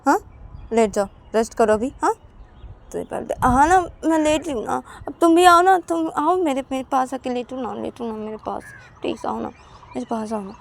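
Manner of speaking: high, pleading voice, begging her to come; coaxing friend. Acted. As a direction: sweetly nag her to lie down beside you. Payal coaxes her to lie down.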